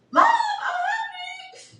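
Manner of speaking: high, slow mock kid yell; kid calling out. Daughter bursting in, calling out to her mom, mock yell. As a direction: call down like a hungry kid. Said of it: "Deja slam the door".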